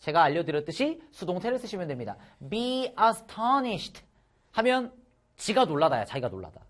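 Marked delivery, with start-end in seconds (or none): 2.5-4.0 drawn-out careful read; slow model reading. Shown as a demonstration. Teacher modelling the passive phrase, very slowly. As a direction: spell it out slowly for students.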